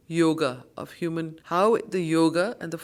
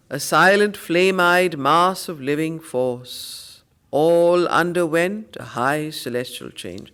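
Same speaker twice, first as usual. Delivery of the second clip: louder, solemn verse reading; poem recitation; 7 dB louder, slower than usual. Acted. Teacher reciting Savitri lines to students, loud and solemn. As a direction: declaim the verse like a hymn.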